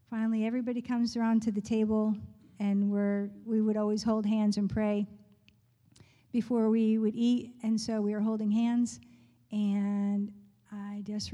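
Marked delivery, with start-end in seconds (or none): none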